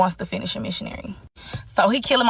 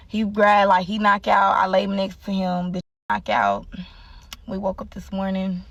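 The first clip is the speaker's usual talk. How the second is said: ordinary talk, nothing marked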